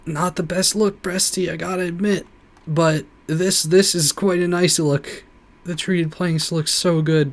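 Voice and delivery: excited. Real, not acted. Admiring the planks.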